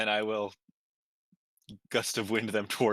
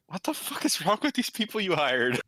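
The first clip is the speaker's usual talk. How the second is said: mock exasperated look voiced; 7 semitones higher than usual. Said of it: "Just looking at her like".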